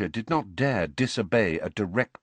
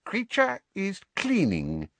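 slow, high house-elf whine; evasive; 14 semitones higher than usual. A narrator's reading. House-elf hiding what he is up to, evasively. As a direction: dodge the question in a high, drawn-out voice. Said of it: "he said evasively".

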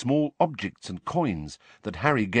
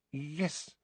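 small, soft, higher hesitant yes; a little nervous; 6 semitones higher, 11 dB quieter than usual. A narrator's reading. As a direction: answer softly, with nervous hesitation. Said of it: "now sounding a little nervous".

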